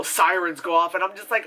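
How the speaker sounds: ordinary talk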